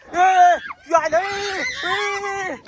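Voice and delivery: high, drawn-out alarmed cries; alarmed yell. Acted. Man sees the ghost coming at him, alarmed yell. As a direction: yell out as it comes closer.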